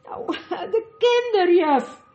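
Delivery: put-on high, loud, broken child bursting into sobs; stammering into tears. A narrator's reading. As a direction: stammer the answer as the tears come. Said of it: "hakkelde Caspian en hij barstte in tranen uit".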